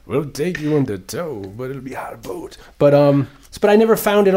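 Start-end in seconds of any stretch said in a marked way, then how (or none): 0.1-2.6 put-on Russian accent. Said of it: "With a Russian accent".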